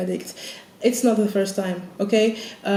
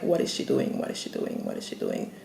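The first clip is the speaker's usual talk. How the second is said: whispered, fading to almost nothing; panicked whisper; 6 dB quieter than usual. Retold or imitated. Whispering her panic at the nurse. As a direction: whisper the same question, getting fainter. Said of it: "I was watching her like".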